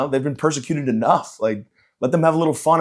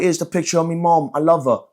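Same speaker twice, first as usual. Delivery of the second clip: high-pitched mock British accent; hammed-up British speech-giver, pitched up; Prince Harry; 6 semitones higher than usual. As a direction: put on a broad, cheeky English accent. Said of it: "pretended to be Prince Harry on stage".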